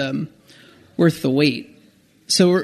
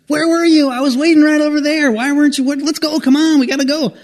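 much higher and louder, a rush of excited greeting; excited friend; 11 semitones higher, 5 dB louder than usual. Retold or imitated. The friend bursting out of the crowd, much higher and louder with excitement. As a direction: burst in, thrilled and talking over everything.

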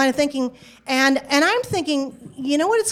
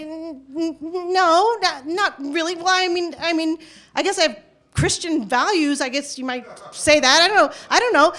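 higher, stammering and tripping over the answer; flustered and defensive; 5 semitones higher, 3 dB louder than usual. Retold or imitated. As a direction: caught off guard, stumbling to find a reply. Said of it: "kind of feel defensive".

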